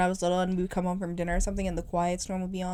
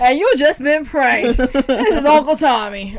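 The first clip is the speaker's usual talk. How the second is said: louder, slower announcer voice, laughing through it; radio prank host; 5 semitones higher, 8 dB louder, slower than usual. Retold or imitated. Friend imitates a morning show prank call host, loud and slow with laughter. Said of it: "those talk shows in the morning".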